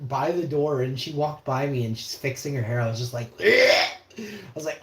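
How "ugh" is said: loud, high disgusted groan; disgusted ugh. Retold or imitated. Reliving the lady walking past, loud high disgusted ugh.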